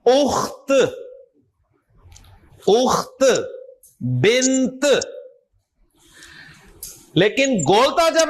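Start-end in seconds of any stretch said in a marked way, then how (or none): 0.0-0.7 اخت with a clearly released t at the end; final t sounded. Shown as a demonstration. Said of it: "تو تا کی آواز آئے گی". 2.7-3.2 اخت ending in a separate, audible t; teacher's demo of اخت with its t pronounced; final t sounded. Shown as a demonstration. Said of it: "تو تا کی آواز آئے گی". 4.0-4.8 بنت with the last t released audibly; final t sounded. Shown as a demonstration. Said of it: "تو تا کی آواز آئے گی".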